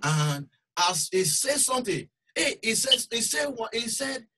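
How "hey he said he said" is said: ordinary talk, nothing marked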